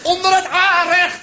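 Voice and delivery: high, slow hammed-up cry; called-out command. Grandpa the war veteran ordering cover, calling out. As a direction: call it like a battle order. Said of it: "riep opa".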